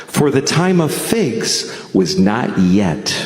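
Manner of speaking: solemn Bible-reading voice, measured; scripture reading. Acted. Comic reading scripture to the crowd, solemn Bible voice. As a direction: read it like a preacher at the pulpit. Reads scripture in King James style.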